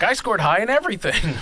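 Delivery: high-pitched, lightly mocking excitement; mock eager boast. Host imitating herself at twenty-five taking strengths tests, mock eager. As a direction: imitate an overconfident young test-taker. Mimics naive twenty-five-year-old's test result.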